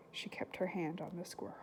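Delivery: put-on lively read-aloud to children; story reading. A narrator's reading. Teacher reading a novel aloud to her class, lively. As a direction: bring the chapter to life for the class.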